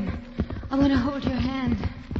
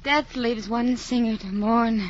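usual speaking voice

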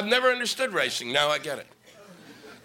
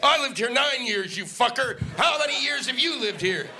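raised, indignant mock rant at another driver; mock angry driver; 6 semitones higher than usual. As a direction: yell it like a furious local. Acted road rage quarrel.

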